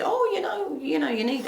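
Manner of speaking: lilting high-pitched mimicry; retold woman's voice. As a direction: lift it up high, quoting a friend. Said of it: "I'm not gonna do the accent".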